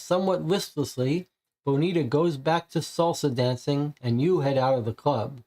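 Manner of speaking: card read aloud as a storyteller; story narration. Acted. Game host narrating the visit to the club, storyteller voice. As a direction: narrate the scene like a game master.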